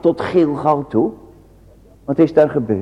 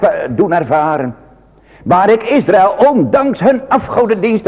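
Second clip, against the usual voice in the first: higher, louder pulpit plea; preaching at full force; 6 semitones higher, 4 dB louder than usual. Acted. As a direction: urge the people to remember. Preaching as the Lord. (hoger en luider pleitend vanaf de kansel)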